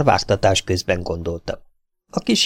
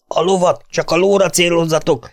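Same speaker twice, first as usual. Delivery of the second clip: shouted order; 8 semitones higher than usual. A narrator's reading. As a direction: bark the order across the gunfire. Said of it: "kiáltotta Náró".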